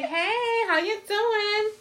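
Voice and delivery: high, slow sing-song hello; mock flirty greeting. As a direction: coo it like you're hitting on him. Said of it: "It'd be awkward if I be like".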